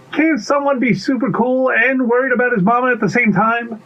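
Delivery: high-pitched character protest; defensive little pea. Acted. Lost pea defends worrying about mama, high and defensive. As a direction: insist on it in a high voice.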